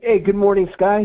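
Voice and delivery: ordinary talk, nothing marked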